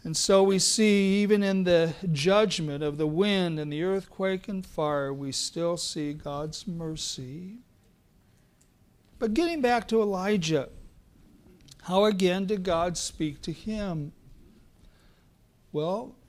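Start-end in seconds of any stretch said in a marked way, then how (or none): none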